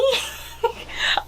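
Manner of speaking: a sudden airy laugh up high; high breathy laugh. Real, not acted. Amused at her own defiant quote, airy high laugh.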